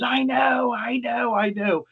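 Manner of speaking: high, overcome mock wail; imitated weepy drunk. Drunk visitor agreeing with the doctor, mock-emotional. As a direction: gush it like a tearful drunk. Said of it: "drunks can cry at the drop of a hat".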